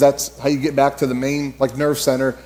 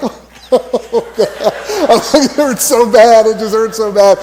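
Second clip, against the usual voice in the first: higher, louder mock-pained groaning; pained whine; 6 semitones higher, 4 dB louder, slower than usual. After the instructor turns away, mock pained moan. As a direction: whimper it like it really hurts. In pain after hitting platform.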